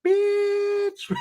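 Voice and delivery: a lowrider car talking trash; car's voice. Retold or imitated. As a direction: voice the car like it's talking back. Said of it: "it went".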